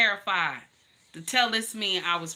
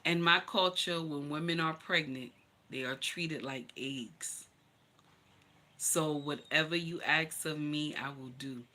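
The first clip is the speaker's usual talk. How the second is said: low and much softer, slowing down; hushed, lower voice, a loving promise; tender quiet vow; 5 semitones lower, 6 dB quieter than usual. Retold or imitated. Husband softly promising his pregnant wife, low and tender.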